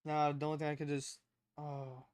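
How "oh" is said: ordinary talk, nothing marked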